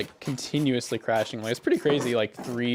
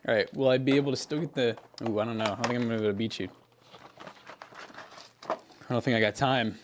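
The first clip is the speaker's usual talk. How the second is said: ordinary talk, nothing marked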